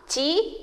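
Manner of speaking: "ti" held alone as one syllable; isolated syllable. Shown as a demonstration. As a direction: say "ti" detached, then stop. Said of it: "la première syllabe". (« ti », syllabe bien détachée)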